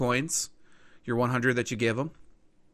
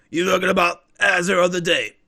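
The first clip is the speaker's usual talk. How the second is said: louder, hyped-up tale-seller's voice; gruff-dealing informant; 5 semitones higher, 9 dB louder than usual. Acted. Game master as informant selling a treasure rumour, intriguing. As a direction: sell the legend like a shady informant.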